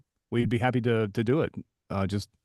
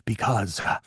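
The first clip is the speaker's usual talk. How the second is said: Jack Palance impression. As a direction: imitate a grizzled film star, pausing on his breath. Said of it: "And he goes".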